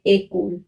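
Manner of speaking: "एकोल" starting with ay: ay-kol; accented first vowel. Shown as a demonstration. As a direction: open "एकोल" on a clear French é. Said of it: "क्या pronounce करेंगे?".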